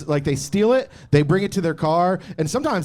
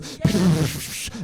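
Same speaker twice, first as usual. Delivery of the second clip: long hissing spray imitation; fart spray noise; 3 dB quieter than usual. Telling friends how the prank spray goes off, imitated hiss. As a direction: imitate an aerosol going off. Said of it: "fart spray goes".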